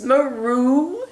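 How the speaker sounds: a startled cat; cat hissing. Acted. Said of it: "who hissed".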